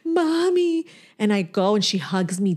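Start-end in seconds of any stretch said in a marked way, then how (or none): 0.0-0.9 put-on crying child. Seven-year-old calling to her mother, imitated crying. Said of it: "She's crying".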